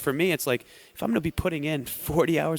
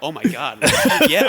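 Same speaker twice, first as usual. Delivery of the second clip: excited laugh; 12 semitones higher, 7 dB louder, slower than usual. Retold or imitated. Acted hyped reaction to coach.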